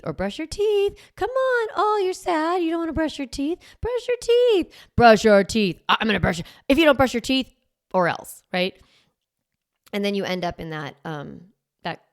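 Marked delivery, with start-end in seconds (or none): 0.1-4.7 put-on much higher, sing-song coaxing like to a toddler; sugary coaxing. Parent sweet-talking a child into brushing, high sing-song. 5.0-8.4 louder, stern or-else warning; threatening parent. Retold or imitated. Parent threatening child who won't brush, loud and stern.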